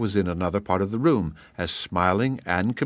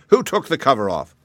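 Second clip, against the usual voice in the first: angry; 5 semitones higher, 4 dB louder than usual. A narrator's reading. King furious at escape.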